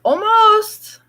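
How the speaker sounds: sudden high outburst; surprised almost. Real, not acted. Speaker realizing the bar hour, surprised high cry. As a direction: blurt it out in sudden disbelief.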